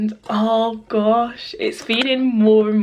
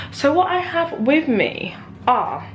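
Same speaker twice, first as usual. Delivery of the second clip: normal talking voice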